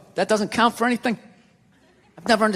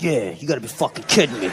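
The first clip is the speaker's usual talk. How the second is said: low, grumbling dog voice; dog echoing owner; 10 semitones lower, 3 dB quieter than usual. Retold or imitated. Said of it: "dog was over in the corner being like".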